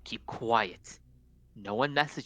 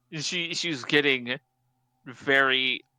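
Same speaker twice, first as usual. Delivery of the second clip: normal talking voice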